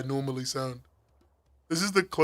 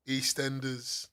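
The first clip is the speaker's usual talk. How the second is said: slow, lower, softer, heavily English "EastEnders"; exaggerated English accent; 3 semitones lower, 7 dB quieter, slower than usual. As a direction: drawl "EastEnders" like a plummy English announcer. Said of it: "You've sounded offensively English there".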